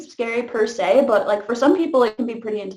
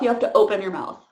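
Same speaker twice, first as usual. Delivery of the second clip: normal talking voice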